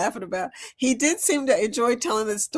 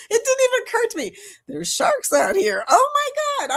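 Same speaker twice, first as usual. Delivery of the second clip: surprised; 13 semitones higher than usual. Retold or imitated. Retelling realizing sharks.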